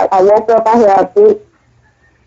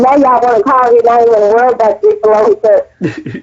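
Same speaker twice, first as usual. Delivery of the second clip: normal talking voice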